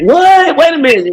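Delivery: high-pitched, startled double take; acted surprise. Co-host acting out hearing the old beat, surprised. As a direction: act out a listener caught off guard, pitch jumping up. Acts out listener's startled reaction.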